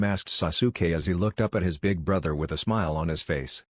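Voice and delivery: plain reading voice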